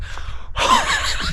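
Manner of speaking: high breathless squeal of a laugh. Real, not acted. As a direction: laugh out at the icy shock. Laughing after the cold splash.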